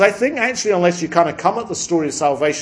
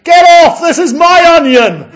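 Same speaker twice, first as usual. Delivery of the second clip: high, very loud, with a laugh; high, very loud furious old woman; furious shout; 14 dB louder than usual. Acted. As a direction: scream them off her onion, half laughing.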